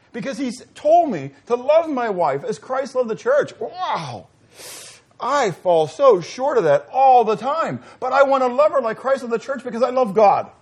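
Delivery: high, loud fervent sermon; preaching at full force. Acted. Preacher to congregation about loving his wife: loud fervent preaching. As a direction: pour it out, building to a peak.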